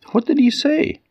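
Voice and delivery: tiny, high mouse princess voice; cartoon princess. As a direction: squeak it like a little mouse.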